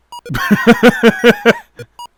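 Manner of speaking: high pitched exasperated laugh. Real, not acted. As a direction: laugh it off, squeaky. Laughing at own failure.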